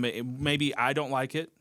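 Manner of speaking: ordinary talk, nothing marked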